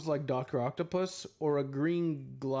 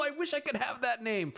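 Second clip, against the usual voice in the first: wistful; 11 semitones higher than usual. Retold or imitated. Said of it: "I was like".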